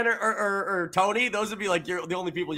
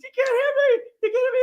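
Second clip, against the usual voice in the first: mock Diggle squeal; 3 dB louder, much faster than usual. Diggle retold, muffled behind door.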